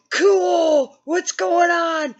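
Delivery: put-on loud, high gleeful kid, slow on the question; thrilled teen who doesn't get the danger; clueless excited teenager; naive excitement. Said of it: "and I'm like".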